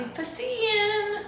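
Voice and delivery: sudden high squeal on Piscean; high excited squeal; excited call-out. Real, not acted. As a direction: shout the sign out happily. Calls out sign found.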